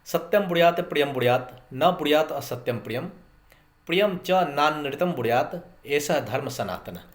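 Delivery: measured, solemn recital of a Sanskrit verse; quoting scripture to trainees, recited solemnly; Sanskrit recitation. Shown as a demonstration. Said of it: "Manusmriti में कहा गया है".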